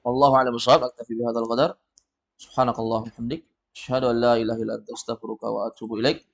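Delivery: soft, fast murmured recitation; closing prayer. Real, not acted. Teacher closes the class with a soft prayer. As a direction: rattle off the closing prayer quietly.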